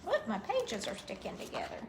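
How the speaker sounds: plain reading voice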